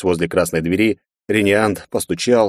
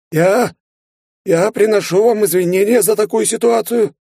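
choked, hoarse high apology; strained hoarse voice; 3 dB louder than usual. A narrator's reading. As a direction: squeeze it out hoarsely. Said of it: "сдавленно прохрипел".

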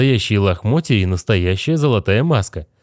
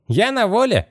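high, gleeful skeleton shout; excited exclamation; 12 semitones higher than usual. Acted. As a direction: cry out the joy of freedom, high-pitched. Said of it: "воскликнул он".

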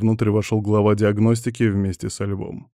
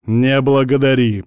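loud, raised, irritated comeback; growled retort; 4 semitones higher, 8 dB louder than usual. A narrator's reading. Лев answering a jibe about his aim, growled retort. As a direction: throw it back as an angry growl. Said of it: "прорычал Лев".

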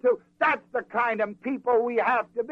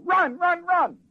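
urgent call; 10 semitones higher, 4 dB louder, slower than usual. Acted. Prophet urging servant to run.

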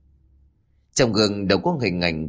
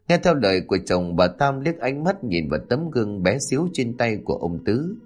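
usual speaking voice

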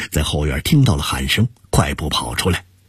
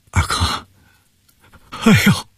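tearful; 11 semitones higher than usual. Acted. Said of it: "王泽民声泪俱下".

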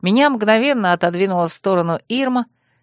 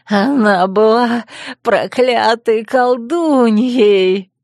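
loud, slow crazed cry; a madman screaming about the witch; cackling madman; 3 semitones higher, 5 dB louder, much slower than usual. Acted. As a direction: spit out the curse with a giggle. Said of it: "Снова захихикал Гаспар".

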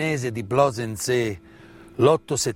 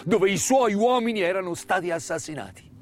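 higher, lyrical declaiming over music; impassioned oration; 9 semitones higher than usual. Acted. Orator mourning murdered farmworkers at their memorial, impassioned and lyrical. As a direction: recite it like a solemn elegy for the fallen.

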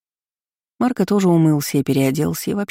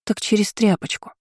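eager; 6 semitones higher than usual. A narrator's reading. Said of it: "охотно пояснила Жнеда".

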